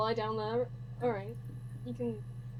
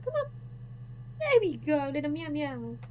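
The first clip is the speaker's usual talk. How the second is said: pet talk; 3 semitones higher than usual. Real, not acted. Streamer coaxing her cat onto her lap, high baby talk. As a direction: coax him up sweetly, much higher.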